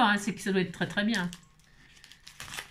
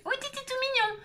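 much higher, softer cooing; 13 semitones higher, 4 dB quieter than usual. Real, not acted. Cooing over cute charm. (roucoulement aigu et doux)

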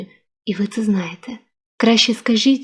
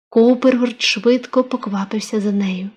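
ordinary delivery, nothing marked

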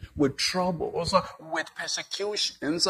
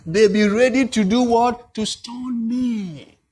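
Moses exclaiming; 7 semitones higher, 9 dB louder than usual. Acted. Preacher voices Moses to married couples, loud and indignant. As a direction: hammer the question, then stretch out the last words. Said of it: "You heard Moses".

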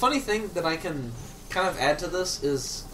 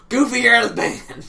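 louder, higher mock yell; quoting a movie line; 6 semitones higher, 9 dB louder than usual. Friends quoting a film line, loud mock yell. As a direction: bark it like the famous movie line. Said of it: "Goofy's problem".

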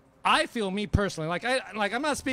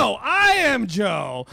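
mock fan reply; 2 semitones higher, 6 dB louder, slower than usual. Mocking fan's reply.

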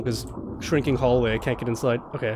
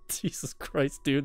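soft, slow, whispered exhale; shaken relief; 8 dB quieter, much slower than usual. Real, not acted. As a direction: let it out under the breath. Relieved after the scare.

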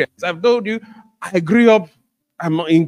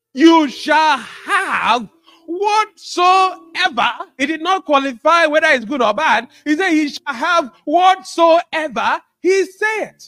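slow emphatic preaching; 7 semitones higher, slower than usual. Acted. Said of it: "preacher drawls key phrase".

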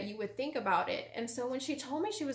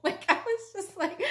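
higher, louder burst through laughter; laughing; 9 semitones higher, 6 dB louder than usual. Real, not acted. Recalling her shock at sister's churchgoing, laughing. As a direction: laugh your way into the words.

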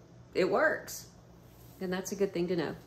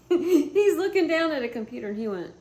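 talking high and loud through laughter; laughing; 7 semitones higher, 6 dB louder than usual. Real, not acted. To viewers, higher, louder laughing speech. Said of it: "make the face that he made".

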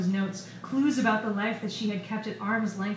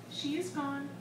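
coy; 6 semitones higher, 7 dB quieter than usual. A narrator's reading. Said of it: "The boy shrugged coyly".

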